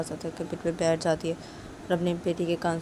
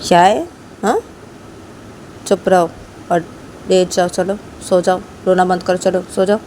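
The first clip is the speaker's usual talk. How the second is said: stern scolding; 10 dB louder than usual. Acted. Parent scolds crying child.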